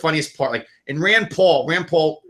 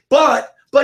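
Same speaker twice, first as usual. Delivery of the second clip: high, louder flustered sputter; imitating a TV reporter sputtering when cut off; flustered stammer; 11 semitones higher, 6 dB louder than usual. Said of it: "she kept saying".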